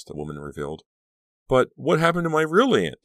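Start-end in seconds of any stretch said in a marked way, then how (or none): 1.5-3.0 high, loud insistent question; demanding. A narrator's reading. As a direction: demand an answer, voice raised high. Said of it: "Danielle demanded".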